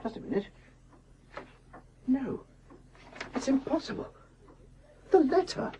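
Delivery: hushed, rising amazement; astonished discovery. Acted. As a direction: gasp out the find. Finding the hidden letter.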